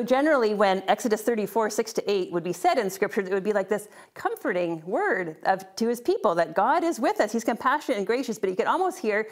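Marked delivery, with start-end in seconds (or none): none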